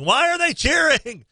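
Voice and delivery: high, slow incredulous mock yell; retold yell. Host retells yelling at Kentucky fans, high mock yell. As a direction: yell the question up high, baffled. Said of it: "I'm yelling".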